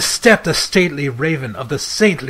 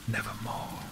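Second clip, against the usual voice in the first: soft unvoiced croak of a raven; hushed raven answer; raven's whisper; 5 semitones lower, 17 dB quieter than usual. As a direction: breathe the word like a dark bird.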